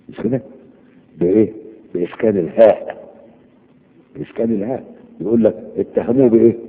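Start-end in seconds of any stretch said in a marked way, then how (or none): none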